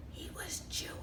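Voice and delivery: hushed, dropping into a whisper; secret whisper. A narrator's reading. Storyteller telling children baby Moses's secret, whispered. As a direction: confide it as a dangerous secret.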